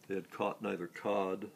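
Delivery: plain reading voice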